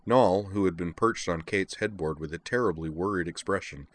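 ordinary delivery

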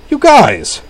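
high, loud amazed cry. A narrator's reading. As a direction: call out in disbelief.

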